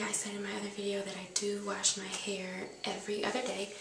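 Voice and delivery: normal talking voice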